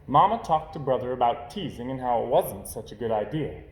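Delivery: ordinary delivery, nothing marked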